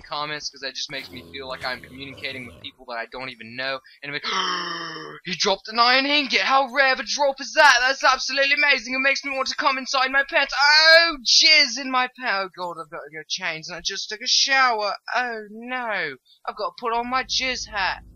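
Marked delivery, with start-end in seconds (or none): 4.3-5.2 big exaggerated gasp; shocked gasp. Player seeing a rare drop, exaggerated gasp. 5.2-12.5 high, loud gushing that builds to a shout; over-the-top excitement. Pretend. Player thrilled by a rare iron drop, hammed-up excitement. 12.6-18.0 high, theatrical lament; mock dismay. Player needing to change after a shower, mock dismay.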